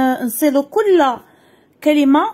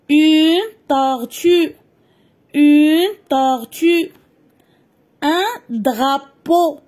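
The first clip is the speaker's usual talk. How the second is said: each word drawn out slowly with a rising "une" on "tortue"; slow clear dictation; slower than usual. Shown as a demonstration. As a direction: dictate slowly so children catch every sound. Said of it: "نسمعو مزيان النطق ديال كل كلمة". (إملاء بطيء وواضح لكل كلمة)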